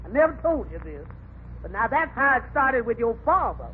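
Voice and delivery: normal talking voice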